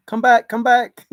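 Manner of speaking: loud urgent call. Real, not acted. To a guest who vanished from chat, loud calling. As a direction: call them back, loud and pressing.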